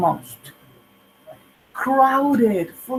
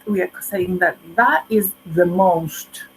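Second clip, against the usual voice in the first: normal talking voice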